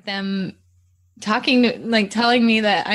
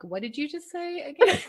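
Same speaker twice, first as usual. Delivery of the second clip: higher, incredulous questioning; mock disbelief; 7 semitones higher than usual. Wife to husband after his accent slips, higher mock-disbelieving question. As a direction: question it as if you misheard. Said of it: "I'm like".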